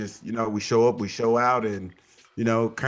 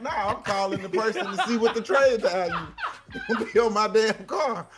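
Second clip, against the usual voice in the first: laughing retelling; 9 semitones higher than usual. Retold or imitated. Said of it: "I'm like".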